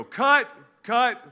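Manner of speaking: sharp high loud call of cut. Retold or imitated. Director halting the read-through, loud high call. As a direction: stop the scene, shouting cut. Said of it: "then they go".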